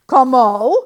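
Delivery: very loud, furious rising spit; spat in anger. A narrator's reading. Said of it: "spat the farmer".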